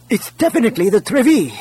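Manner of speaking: high-pitched, emphatic call; excited confirmation. A narrator's reading. Console operator confirms the ship to the crowd, excitedly.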